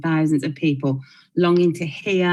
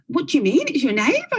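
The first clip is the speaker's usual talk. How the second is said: much higher, incredulous mock question; baffled disbelief; 9 semitones higher than usual. Caller reacting to a friendly neighbour, incredulous. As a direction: imitate a caller who can't believe it. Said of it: "She's like".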